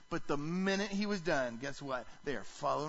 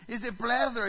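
"plethora" with a light Spanish accent, flat-a "plah-THO-ra", said louder and higher; playful Spanish accent; 5 semitones higher, 7 dB louder than usual. Shown as a demonstration. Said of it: "Can I say it with a little Spanish accent?".

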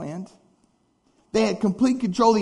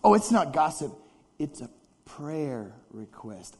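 mock pious excuse; 6 semitones lower, 3 dB quieter than usual. Preacher mimicking a gossiping churchgoer, mock pious. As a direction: pretend piety to cover gossip. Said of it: "It goes like this".

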